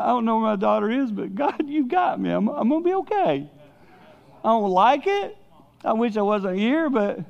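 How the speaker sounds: high-pitched voice as if laughing through worry; re-enacted giddy distress. Father replaying his prayer about his missing daughter, giddy distress. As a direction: plead upward, close to hysterical laughter.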